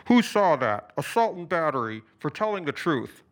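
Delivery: broadcast it to the crowd through the bullhorn. A narrator's reading. Alex announces the assault to the crowd through the bullhorn. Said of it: "raised it to address the small crowd".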